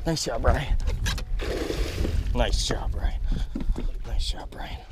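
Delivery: breathy hushed whisper; whispered praise. Real, not acted. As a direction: whisper it so the fish won't hear. Praising buddy during the fight.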